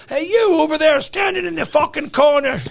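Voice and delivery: put-on botched British accent drifting Scottish; Gordon Ramsay. Guest imitating an angry TV chef on the show, botched Scottish-tinged accent. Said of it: "I love Gordon Ramsay. ... he said".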